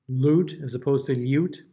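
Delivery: lute as loot, then lyoot; first lute plain, second with y glide; lute without and with ya. Shown as a demonstration.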